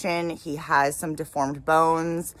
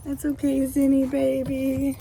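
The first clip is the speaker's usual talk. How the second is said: sweet high pet voice; 10 semitones higher than usual. Real, not acted. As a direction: soothe the frightened little animal. Comforting the scared lemur.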